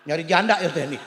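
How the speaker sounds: ordinary talk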